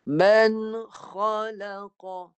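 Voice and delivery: "Man khalaqa" drawn out very slowly, the kha touching the throat; teacher modelling the phrase; recitation demo. Shown as a demonstration. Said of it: "cuba baca".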